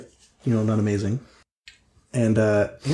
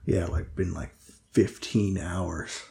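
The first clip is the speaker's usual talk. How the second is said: low, quiet, halting role voice; subdued Linus, weary and hesitant; 5 semitones lower, 4 dB quieter than usual. Acted. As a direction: speak low and softly, with hesitant pauses. Game master voices Linus.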